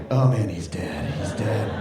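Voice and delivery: very low, slow groan of doom; comic dread. Retold or imitated. Dad foreseeing his son's teasing, very low mock dread. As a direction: mutter it deep as a death sentence. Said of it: "again, I'm like".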